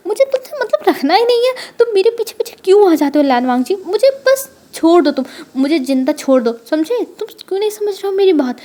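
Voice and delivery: furious, pleading to be left alone; angry shout. A narrator's reading. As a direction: shout him off, exasperated. Wing fed up, yells at Wangji.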